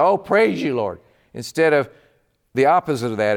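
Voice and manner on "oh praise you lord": gushing praise. Retold or imitated. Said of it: "everything she said".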